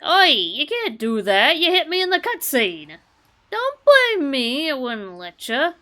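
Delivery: loud, high mock outrage; mock indignant shouting. Gamer scolding the enemy who hit him in the cutscene, loud mock outrage. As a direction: yell at the cheater in mock outrage.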